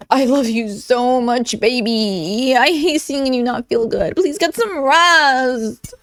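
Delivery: drawn-out syrupy mock concern; insincere gushing. Reading the girlfriend's sick-day text aloud, insincere gushing. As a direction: gush slowly, stretching the ends like fake sympathy. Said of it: "This is about as authentic as Chantal caring".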